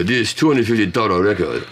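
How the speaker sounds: mock Japanese-accented English. Imitating the clerk at Disc Union in Japan, Japanese accent. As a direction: quote the price in a put-on Japanese accent. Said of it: "I love doing accents".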